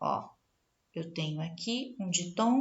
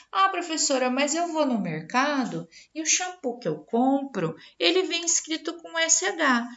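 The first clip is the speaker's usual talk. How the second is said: imitating a student; 6 semitones higher than usual. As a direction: play the pupil protesting to the teacher. Teacher voices an objecting student.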